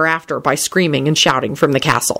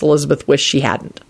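plain reading voice

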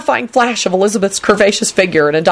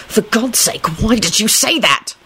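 hushed, higher-pitched alarm; frightened and a bit higher; 4 semitones higher, slower than usual. A narrator's reading. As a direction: plead in mild panic. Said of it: "he replied in a frightened voice".

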